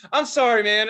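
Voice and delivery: high, loud mock-flustered apology; panicked apology. Follower apologises as the comic turns round, mock-panicked blurt.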